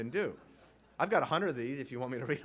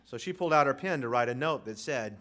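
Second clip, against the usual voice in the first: usual speaking voice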